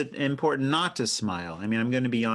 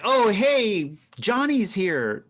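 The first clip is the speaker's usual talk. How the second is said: higher, louder imitated hello; eager greeting; 8 semitones higher, 4 dB louder, much slower than usual. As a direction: greet a newcomer right away, drawn out. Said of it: "I greet them right away".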